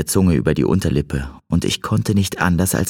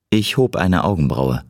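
ordinary delivery

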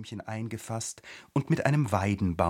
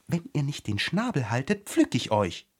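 ordinary delivery, nothing marked

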